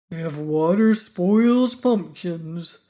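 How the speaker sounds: slow, heavy, sighing pumpkin head; sighing gloomily. A narrator's reading. Pumpkin head fears for his life to Tip, slow sighing gloom. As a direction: let each word sink like a sigh. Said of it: "observed Jack with a deep sigh".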